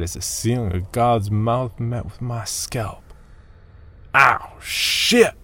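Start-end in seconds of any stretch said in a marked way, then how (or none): 4.1-5.3 sudden yelp of pain, higher and louder; pained shout. Acted. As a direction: cry out as the head hits rock. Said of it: "I shouted".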